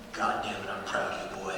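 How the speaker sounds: low, hushed granddad growl of pride; soft and low, a mock scolding. Granddad secretly praises his grandchild before the crowd, low imitated voice. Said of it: "all the crowd can see him scold me".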